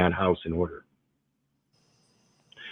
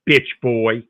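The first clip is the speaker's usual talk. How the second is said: ordinary delivery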